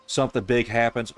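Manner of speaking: ordinary talk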